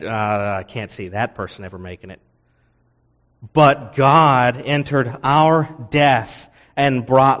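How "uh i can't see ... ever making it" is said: much softer and fast, a quick dismissive mutter; dismissive doubt. Retold or imitated. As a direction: toss it off under your breath. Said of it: "someone who knew us who said".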